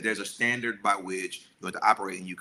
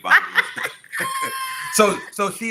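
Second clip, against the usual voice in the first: laughs right through the words, pitched up; laughing; 9 dB louder than usual. Real, not acted. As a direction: bursts out laughing at his own joke. Joking she paid.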